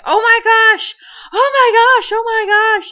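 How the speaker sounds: high, loud, panicked squeal; loud freak-out, pitch soaring. Retold or imitated. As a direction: cry out in shock, voice shooting way up. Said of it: "I'm freaking out".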